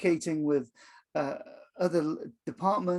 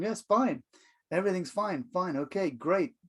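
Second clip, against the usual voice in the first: upbeat; 2 semitones higher than usual. Shown as a demonstration. Employee answering the same greeting, upbeat. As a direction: say all is fine and sound like it. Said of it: "Which is different to saying".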